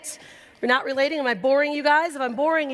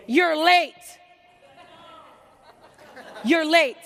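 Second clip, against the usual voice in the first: loud, sharp, higher reprimand; stern scolding; 6 semitones higher, 6 dB louder, slower than usual. Retold or imitated. Strict boss scolding a latecomer, loud and sharp. Jane scolding late woman.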